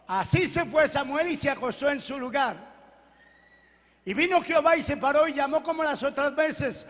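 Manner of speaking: ordinary talk, nothing marked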